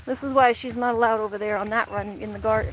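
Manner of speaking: ordinary talk, nothing marked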